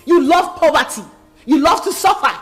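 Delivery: loud, high-pitched tirade; scornful, full-volume dressing-down; furious shouting. Acted. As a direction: shout them down in a rage. Furious ultimatum in a quarrel.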